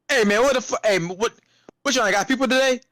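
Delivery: high-pitched irate customer rant; going off. Retold or imitated. Hungry customer demanding a sandwich from overwhelmed staff, high-pitched irate rant. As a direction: imitate a customer griping at the counter. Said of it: "Just going off and off".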